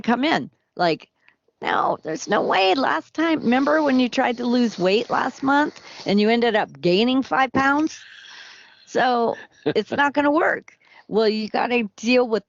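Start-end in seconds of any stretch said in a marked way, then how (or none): 1.6-10.8 higher, mocking voice of the inner skeptic; doubting inner voice. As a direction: play the nagging doubt that shoots your hopes down. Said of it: "whatever voices come in or the, the counter intentions".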